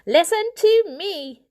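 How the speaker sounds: loud commanding call; demanding. A narrator's reading. Elder Poor demands to be heard, loud. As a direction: raise the voice to take control. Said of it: "demanded the elder Poor".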